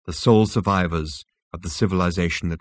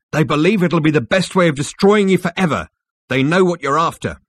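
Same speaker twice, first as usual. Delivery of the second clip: loud, high, forceful character voice; Rooster insistent; 9 semitones higher, 7 dB louder than usual. Acted. Rooster tells Zaphod why they want him destroyed, loud and insistent. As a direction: hammer the explanation home loudly.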